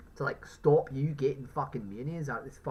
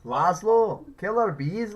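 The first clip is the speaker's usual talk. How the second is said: louder, higher mock caller yell; shouted radio caller impression; 6 semitones higher, 8 dB louder, slower than usual. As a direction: call out like a panicked radio caller. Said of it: "one of the callers ... talking about killer bees".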